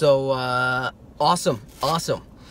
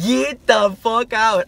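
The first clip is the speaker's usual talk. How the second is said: disbelief; 5 semitones higher, 6 dB louder, much slower than usual. Retold or imitated. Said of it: "I'm like".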